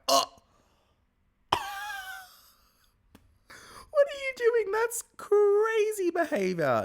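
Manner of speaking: high, loud, disbelieving yelp; shocked outcry. Retold or imitated. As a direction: react like you just saw something outrageous. Host reacts to spitting in face.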